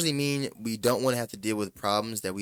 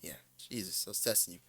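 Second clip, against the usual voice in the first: mock dismissive daughter; 3 semitones lower, 13 dB quieter, faster than usual. Daughter brushing off her dad, in a soft mock-dismissive voice. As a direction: lightly imitate a teen shrugging off her dad. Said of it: "She, she said this".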